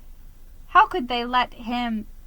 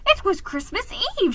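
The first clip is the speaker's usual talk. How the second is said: usual speaking voice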